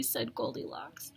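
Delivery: plain reading voice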